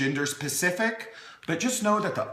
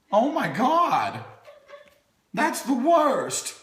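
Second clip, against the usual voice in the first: much higher, slow, hammed-up horror; mock dramatic dismay; 9 semitones higher than usual. To the baby about bedtime, mock dramatic dismay. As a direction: overplay the tragedy of it.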